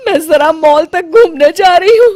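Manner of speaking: high, loud crying whine; whining mother-in-law. Acted. Mother-in-law, stuck in the gown, whines at the bahu. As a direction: wail while begging for help.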